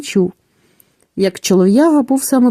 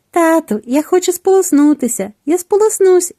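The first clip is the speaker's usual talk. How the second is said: lightly voiced little gosling, higher; a small chick begging to swim, pitched up; gosling's voice; 6 semitones higher than usual. Gosling asking its father to bathe, lightly voiced child. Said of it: "і сказало".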